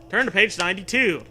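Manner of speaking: ordinary talk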